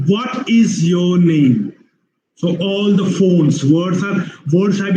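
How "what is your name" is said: "What is your name" slow, every word separate; slow, measured "What is your name"; full uncontracted form. Shown as a demonstration. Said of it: "What it is".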